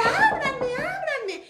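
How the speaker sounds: high, loud cry to be let in; urgent calling out. Acted. Mary Magdalene begging the disciples to open up, urgent cry. Mary calls at the closed door.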